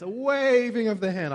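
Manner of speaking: a theatrical flourish on "a waving of the hand"; savoring the phrase. Shown as a demonstration. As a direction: roll it out with relish. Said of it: "I like saying that".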